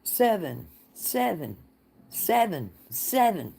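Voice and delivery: high sing-song chant of each seven; imitating the jackpot machine calling out; slot machine voice. To a chat viewer, imitating the slot machine's payout call. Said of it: "and it went".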